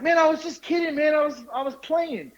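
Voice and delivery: high, defensive backpedalling; mock pleading excuse. Storyteller placating the angry driver, mock pleading.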